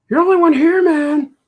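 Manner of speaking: high, loud insistent yell; critic yelling. Acted. The critic character insists to Baltazar, high loud yell. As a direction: shout it up high at him. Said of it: "he has to yell at me all the time".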